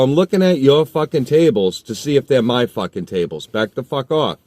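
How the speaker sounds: loud, high furious warning; angry shout. Retold or imitated. Shoving the kid back, loud furious shout. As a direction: bark it in his face. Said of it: "I go".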